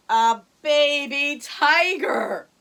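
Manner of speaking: slow, loud reveal; dramatic reveal. A narrator's reading. Storyteller revealing the tiger, slow and loud. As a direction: draw out the reveal for effect.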